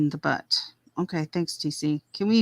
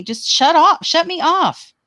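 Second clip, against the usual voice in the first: much higher and louder, fed up; exasperated outburst; 11 semitones higher, 5 dB louder, slower than usual. Real, not acted. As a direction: blurt it out in exasperation. Heated rant at critics.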